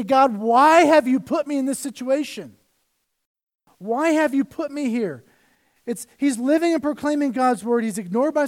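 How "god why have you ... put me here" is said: louder, higher, pleading cry; crying out to God. Acted. Preacher voicing Jeremiah crying out to God, louder and higher. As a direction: plead with God, voice raised in anguish. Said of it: "Jeremiah crying out to God".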